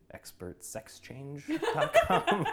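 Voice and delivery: slow, high, breaking into laughter; laughing punchline. Real, not acted. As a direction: drag out the punchline while cracking up. Punchline of the URL joke.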